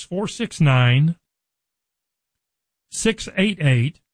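slow, digit by digit with long pauses; slow dictation. Real, not acted. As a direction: read the number out slowly for note-taking. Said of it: "I'm gonna say it slow enough".

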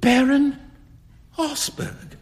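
high, aghast gasp of the name; horrified cry. A narrator's reading. Huey learns who the beggar was, horrified cry. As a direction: voice leaps up, then loses its breath. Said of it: "cried Huey".

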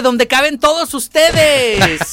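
playful cheesy host line; cheesy radio host. Acted. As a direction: ham up the sweet line.

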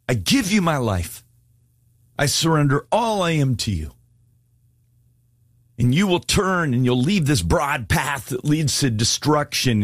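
fervent altar-call preaching; impassioned altar call. Acted. Altar call at full force.

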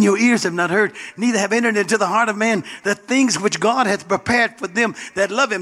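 loud, high, fervent preaching; preaching at full force. Real, not acted. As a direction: preach it hard to the congregation. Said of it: "let me calm down".

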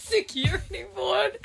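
high-pitched giggly echo; laughing mimicry. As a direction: repeat the blunder, cracking up. Laughing repeat of mispronounced charcuterie.

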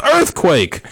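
high, loud mock alarm cry; yelled warning. Bishop tricking the firing squad, loud mock yell. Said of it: "And suddenly the bishop yelled".

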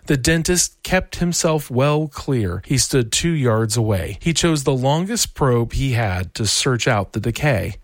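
ordinary delivery, nothing marked

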